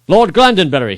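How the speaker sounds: high, loud summons; king calling to the lord. Acted. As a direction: call his name across the arena. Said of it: "he called out".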